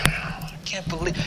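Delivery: whispered under the breath, exasperated; mock frustrated whisper. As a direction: hiss it at a frozen loading screen. Mock frustration at slow internet.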